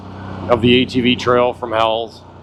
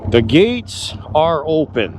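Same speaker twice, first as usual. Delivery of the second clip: slow, drawn-out proclamation with higher pitch; grand proclamation; 4 semitones higher, slower than usual. Pretend. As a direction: proclaim it slowly, letting each word stretch out. Repeats it as grand announcement.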